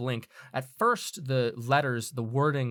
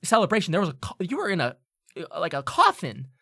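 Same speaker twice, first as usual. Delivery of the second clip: high, loud, stumbling insistence; agitated disbelief; 7 semitones higher, 4 dB louder than usual. Acted. Student recounting the funeral to the returned friend, agitated disbelief. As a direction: pile up the evidence, pitched high and loud.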